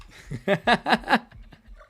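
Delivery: high, loud staccato laugh; laughing hard in quick loud bursts; amused laugh. Real, not acted. Amused by being doubted as British.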